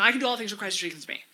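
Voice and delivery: rattled off very fast; rote recitation. Shown as a demonstration. Speaker showing mindless verse reading, rattled off fast. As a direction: race through it without thinking. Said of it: "That's not doing anything for me".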